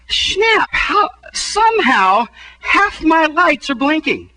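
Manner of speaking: high, stunned disbelief; shocked surprise. Retold or imitated. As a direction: gasp it out like you just saw the lights blinking. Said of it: "and I'm like".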